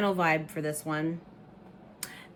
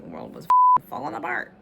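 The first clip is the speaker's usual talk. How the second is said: exasperated outburst; 13 semitones higher, 9 dB louder than usual. Real, not acted. Exasperated aside about world.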